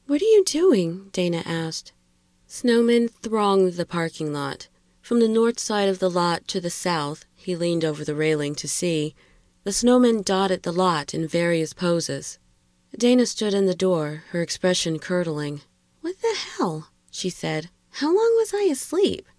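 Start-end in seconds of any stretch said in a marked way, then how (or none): 0.1-1.1 much higher-pitched female character voice; high, startled woman's voice. A narrator's reading. Narrator voices startled Dana asking. 16.0-17.7 very high female character voice; high-pitched, bewildered woman. A narrator's reading. Narrator voices Dana waking to snowmen. 18.0-19.3 very high female character voice; high-pitched, confused woman; bewildered woman character. A narrator's reading. Narrator voices bewildered Dana.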